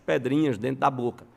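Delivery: ordinary delivery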